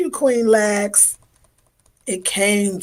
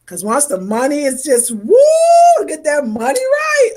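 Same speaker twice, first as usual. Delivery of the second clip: too excited; 6 semitones higher, 6 dB louder than usual. Real, not acted. Host hyping her money course to viewers, excited whoop. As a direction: whoop of joy, then higher and louder hype. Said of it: "Okay, I'm too excited".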